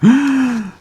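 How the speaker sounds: sharp high shocked gasp; loud startled gasp. Acted. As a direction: suck in air in shock. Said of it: "and gasped".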